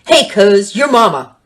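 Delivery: loud, brash jeering voice; taunting call. Acted. A hidden creature taunting Dinky, brash loud jeer. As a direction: jeer at him from the bushes.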